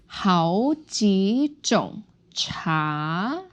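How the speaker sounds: slow 好几种茶 with 茶 rising long; second tone ending. Shown as a demonstration. Teacher models "types of tea" for learners, second tone ending. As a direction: draw out each word, lifting 茶 upward. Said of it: "Second tone".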